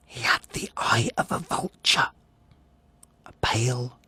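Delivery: whispered horror at the eye. Acted. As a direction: hiss it like a secret. Describing the vulture eye.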